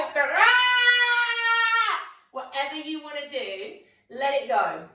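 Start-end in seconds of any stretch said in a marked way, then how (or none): 0.2-2.0 loud, high shouted ah. Shown as a demonstration. Yoga teacher leads class in a loud shouted ah. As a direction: let rip with a big shouted ah. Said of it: "I want you to shout, and go".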